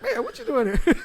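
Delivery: high, laughing "Man, what you doing here?"; laughing surprised greeting. Retold or imitated. Host recalling bumping into his guest everywhere, laughing. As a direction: laugh it out, thrilled to run into him. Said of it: "I'm like".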